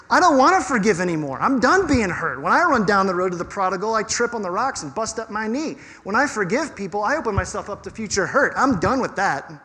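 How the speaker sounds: quick, swooping, fed-up protest; self-protective refusal. Acted. Wounded instinct refusing to forgive anymore, self-protective and insistent. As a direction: act out a hurt person refusing, pitch jumping about. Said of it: "self-protective instinct that comes in".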